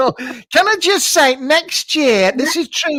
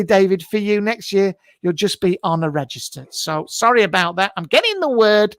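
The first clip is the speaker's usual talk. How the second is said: usual speaking voice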